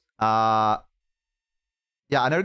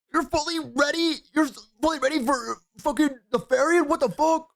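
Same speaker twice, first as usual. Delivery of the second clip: screaming. Real, not acted. Reading chat aloud while screaming, very high shriek. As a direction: shriek it out in disbelief. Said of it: "[name], stop screaming".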